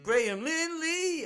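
high, slow eureka cry; triumphant shout. Real, not acted. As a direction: blurt the name in triumph. Suddenly remembers the name.

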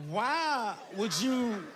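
emotional pleading. Retold or imitated. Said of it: "I'll start to cry".

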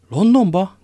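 high, loud incredulous LONDONBA; astonished exclamation. A narrator's reading. Porthos echoes D'Artagnan's destination, crying out in astonishment. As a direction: cry out the question in disbelief. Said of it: "kiáltott fel Porthos".

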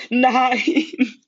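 a piercing cry as the car hits her; screaming. Acted. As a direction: scream her name in horror. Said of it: "schrie ich".

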